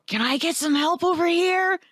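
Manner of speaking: whisper yelling. Acted. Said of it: "Whisper yell".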